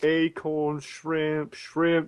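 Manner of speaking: slow Southern shrimp-lover, drawn-out words; Bubba. Acted. Friend listing kinds of shrimp, slow Bubba impression. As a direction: list them in a drawling monotone impression. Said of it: "You sound like fucking Bubba from Forrest Gump".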